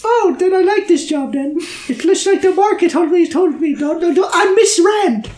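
eager tiny man voice; high and keen, like a small man; high squeaky little man. Acted. Same NPC.

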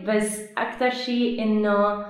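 ordinary delivery

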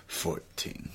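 Fourteen whispered, no voice; whispered aside. Retold or imitated. Podcaster's joke aside to his cohost, whispered. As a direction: breathe the word Fourteen as a secret.

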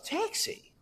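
surprised squeak; shocked. Retold or imitated. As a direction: players caught out by the hotel manager, startled. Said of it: "We're like".

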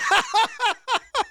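high giggle bursting out; can't help a high laugh; involuntary giggle. Real, not acted. Host laughing again despite himself, high giggle. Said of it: "See, that time was involuntary".

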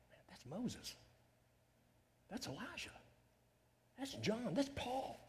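soft, hushed, amazed recognition; awed hush. Pretend. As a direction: breathe the names in quiet wonder. Imagined first sight in heaven.

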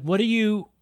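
can't believe what he's doing; exasperated disbelief. Retold or imitated. Ranting at the lost driver.

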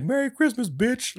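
very high, taunting sign-off; mocking ghost. The ghost sends Scrooge back with a mocking sneer.

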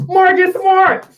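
loud, high-pitched outburst of disbelief; exasperated shout. Real, not acted. Sports host naming who had the ball, exasperated shout. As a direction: shout the name, high and drawn out. Exasperated rant at missed final shots.

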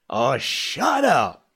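slow, loud, irritable outburst; cried out. A narrator's reading. Scott, annoyed in his bunk, cries out at Matt. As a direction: snap it into the dark. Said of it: "Scott cried out through the darkness".

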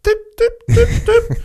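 mock tiny squeak, very high; imitating a choked squeak; high squeaky tip. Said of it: "did you hear this tip".

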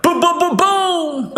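high, loud, punchy boom beat; beatbox boom. Pretend. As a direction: bang out a beat with your mouth. Playful beat after rhymes.

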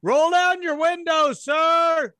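loud, high cop command; a stern officer barking at a car window; police officer. Acted. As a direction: order the driver to roll down the window.

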